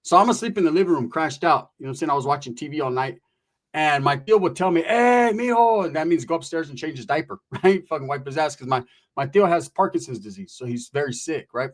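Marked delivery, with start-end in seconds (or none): 4.8-5.8 put-on high, slow call of an old uncle; calling mijo. Sick uncle calling his nephew upstairs, high and drawn out.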